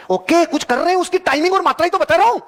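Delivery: high, insistent retort; emphatic retort. Real, not acted. Speaker answering doubters about timing and quantity, emphatic retort.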